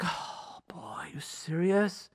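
whispered under the breath, fed up; exasperated whisper. Retold or imitated. A spouse's fed-up inner reaction, whispered. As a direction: mutter it like you can't believe it again.

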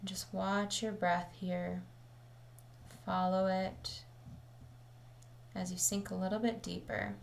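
calm, measured meditation voice with long pauses; settled, even delivery, leaving space between phrases; guided meditation. Acted. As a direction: guide listeners gently into the breath, unhurried.

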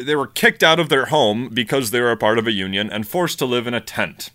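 normal talking voice

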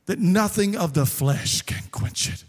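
prayer. Acted. Pastor prays over congregation.

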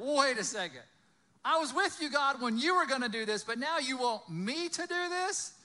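loud, high-pitched objection; incredulous protest. Acted. Moses protesting to God at being sent, loud and incredulous. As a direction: balk as if suddenly landed with the job. Imagined Moses balking at God's order.

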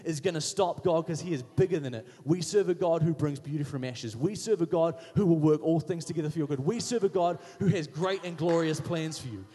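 full-force preaching. Acted. Pastor rallying his church about God, preaching loud. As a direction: preach it to the back row.

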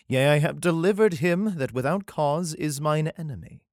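steady, solemn reading of a psalm; scripture reading. Acted. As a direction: read it like a Bible passage. Reads the psalm aloud.

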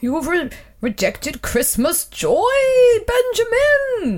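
slow Santa outrage, soaring to a wail on the name; hammed-up Santa lament, drawn out; appalled Santa. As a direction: wail it as a wounded Father Christmas. Santa appalled at rejected Christmas.

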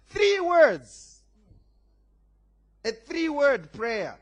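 high, loud preacher's shout; emphatic exclamation. Real, not acted. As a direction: shout it out to drive the point home. Preacher exclaims his point.

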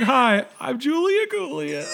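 slow, high, hammed-up sobbing; mock tearful blubbering; imitating crying. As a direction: sob through the introduction. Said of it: "She starts crying".

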